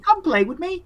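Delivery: put-on high child voice; high-pitched childlike invitation to play; little boy. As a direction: plead like a small boy wanting company. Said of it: "He's like".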